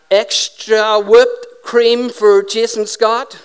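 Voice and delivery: loud, slow, drawn-out announcement; barista calling out. Acted. Barista to waiting customer Jason Scott, calling out. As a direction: holler the order across the shop, stretching the words. Barista calls out the coffee order.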